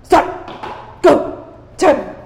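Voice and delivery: sharp mock yells of a driving dad; dad yelling. Impatient dad barking at daughter behind the wheel. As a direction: snap out orders at the learner. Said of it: "He'd be like".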